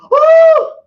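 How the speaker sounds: sudden shrill cry, very high and loud; startled yelp. Acted. Morty suddenly startled, a piercing yelp. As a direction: jump out of your skin with one short shriek.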